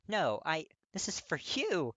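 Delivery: put-on flustered young guy protesting. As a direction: protest it's for him.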